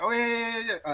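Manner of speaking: high, hurried acted babble; flustered compliance. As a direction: scramble to agree with a superior. Soldier hastily obeys captain.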